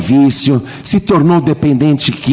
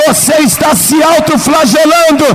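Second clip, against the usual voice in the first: preaching at full force; 10 semitones higher than usual. Acted. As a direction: lift the crowd with soaring preaching. Preacher's climactic exhortation. (pregação fervorosa, alta e aguda)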